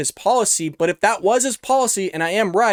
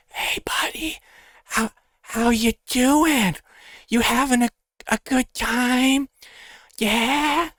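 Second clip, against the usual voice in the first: mock drunk, slow whisper into the mic; drunk guy to a bush; 5 semitones higher, slower than usual. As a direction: imitate a plastered partygoer chatting up a bush, slowly. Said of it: "where he's talking to a bush just like this".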